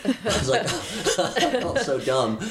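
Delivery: high, laughing through the words; real embarrassed laughter; laughing at himself. Real, not acted. Telling how the lifeguard dragged him ashore, laughing.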